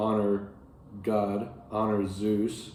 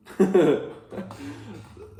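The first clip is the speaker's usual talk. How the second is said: high burst of real laughter; a sudden high laugh at the grim detail; 10 semitones higher, 3 dB louder than usual. Real, not acted. Teacher laughs at the puppy simile.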